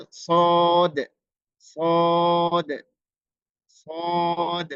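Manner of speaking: সদ said slowly and firmly; emphatic sad. Shown as a demonstration. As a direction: stress সদ heavily. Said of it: "সবাই দেখুন".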